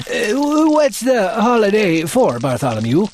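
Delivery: put-on creaky, puzzled old man; old bell ringer woken up. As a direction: ask sleepily.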